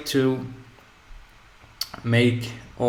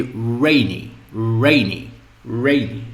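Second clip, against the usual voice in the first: "rainy" with a drawn-out, emphatic first syllable, falling on the second; stress on first syllable; 3 dB louder than usual. Shown as a demonstration. Teacher drilling the example adjective, first syllable of "rainy" stressed. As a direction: lean hard on the start of "rainy". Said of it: "first syllable is the place of the stress".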